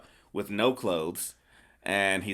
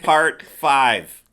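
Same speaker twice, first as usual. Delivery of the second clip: very loud, raised section announcement; mock announcer; 4 semitones higher, 11 dB louder than usual. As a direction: belt it out like a title card. Announcing next chapter of recap.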